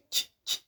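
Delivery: "ch" as short, voiceless bursts; ch sound. Shown as a demonstration. Teacher recalling the C H sound, short soft "ch". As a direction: give two quick soft "ch" sounds. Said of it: "as we said before".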